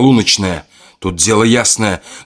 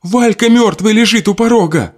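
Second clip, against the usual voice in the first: high anguished shout; desperate cry; 13 semitones higher than usual. Acted. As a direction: cry it out in grief and rage. Adelka shouts about dead groom.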